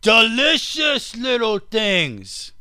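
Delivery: high, slow Aussie savouring voice; Australian caricature, drawn out; mock Australian accent. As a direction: relish it like a joking Australian. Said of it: "do I sound like an Australian?".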